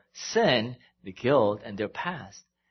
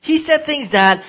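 loud, higher impassioned sermon voice; full-force pulpit delivery; fervent preaching; 7 semitones higher, 10 dB louder than usual. Acted. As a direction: preach it, building the heat. Preaching builds again.

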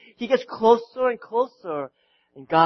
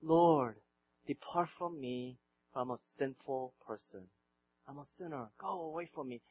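hushed, low, broken confession; Peter's plea; 7 semitones lower, 14 dB quieter than usual. Retold or imitated. Peter quietly begs Jesus to leave him, hushed. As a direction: barely voice the plea, lowered and soft. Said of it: "he says".

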